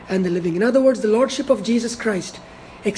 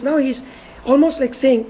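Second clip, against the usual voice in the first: ordinary delivery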